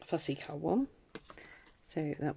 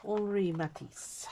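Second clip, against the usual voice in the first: slow, higher French accent on the name; 5 semitones higher, slower than usual. Shown as a demonstration. Crafter crediting a quote's author to viewers, French accent. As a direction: put on your best French accent, drawn out. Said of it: "French accent".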